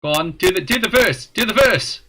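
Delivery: insistent playful demand; excited egging-on. Real, not acted. Eggs singer on to continue.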